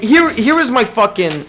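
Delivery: ordinary talk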